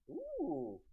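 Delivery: high excited ooh; delighted ooh. Real, not acted. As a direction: squeal with interest. Reacts to fish tank.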